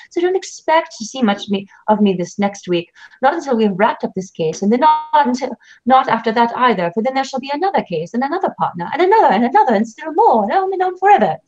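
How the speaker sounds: put-on high, sneering British accent; British accent, hammed-up and mocking. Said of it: "he adopts a British accent".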